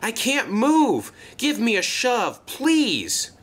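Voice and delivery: high, straining plea; desperate gasp. A narrator's reading. Stuck engine begging Luke for a shove, gasping desperately. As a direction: beg for help while stuck fast. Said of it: "he gasped".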